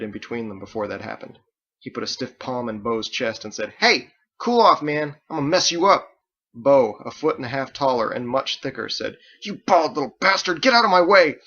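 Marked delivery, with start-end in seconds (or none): 3.8-6.1 loud, higher, menacing; threatening warning. A narrator's reading. Ditch stepping between Bo and Andelin, loud threat. 9.4-11.4 much higher, loud, snarling insult; furious shout. A narrator's reading. Huge Bo shouting at small Ditch to move, furious.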